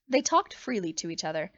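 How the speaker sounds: ordinary delivery, nothing marked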